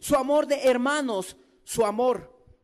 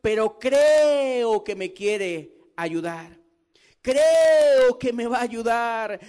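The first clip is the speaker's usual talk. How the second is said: louder, slow, hammering each creo; fiery revival preaching; preaching at full force; 5 dB louder than usual. Acted. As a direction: build the congregation's faith with loud slow conviction.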